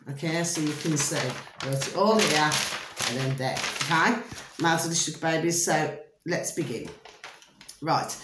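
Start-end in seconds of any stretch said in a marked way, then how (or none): none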